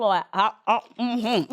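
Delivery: talking mid-bite; words through a crunchy mouthful; chewing while talking. Real, not acted. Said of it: "Sorry, I'm chewing in y'all ear like this".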